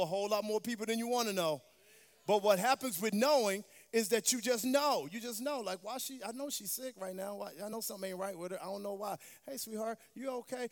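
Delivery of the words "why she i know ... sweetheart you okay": softly acted inner thought; hushed, concerned check-in; quiet musing. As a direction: drop the volume as if puzzling privately. Acting out a sudden intuition, checking in.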